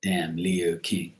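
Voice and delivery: slow whispered curse. Real, not acted. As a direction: hiss it under your breath. Muttered insult aside.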